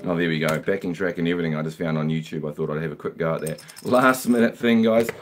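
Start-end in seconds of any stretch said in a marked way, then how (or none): none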